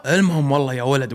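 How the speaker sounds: ordinary delivery, nothing marked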